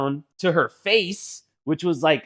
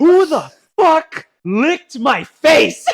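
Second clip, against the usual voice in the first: much higher, louder, slow outraged shout; outraged demand; 12 semitones higher, 8 dB louder, much slower than usual. Retold or imitated. Mom turning to the room after comforting her son, slow outraged shout. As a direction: slowly roar it at the whole room. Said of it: "she turns to the room".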